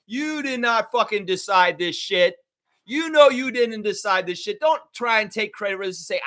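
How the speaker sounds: heated, ranting tirade; building raw furious rant. Real, not acted. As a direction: tear into him with real anger. Holds an angry rant.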